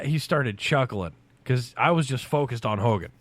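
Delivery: normal talking voice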